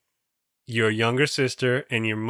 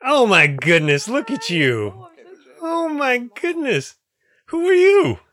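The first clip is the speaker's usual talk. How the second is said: voice up octaves; 12 semitones higher, 5 dB louder than usual. Real, not acted. Host greets a child not seen in so long, high gushing voice. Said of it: "my voice just went up a couple octaves".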